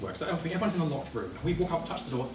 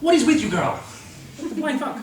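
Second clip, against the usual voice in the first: high, loud outraged cry; shocked yell; 13 semitones higher, 9 dB louder than usual. Retold or imitated. Retold shocked reaction.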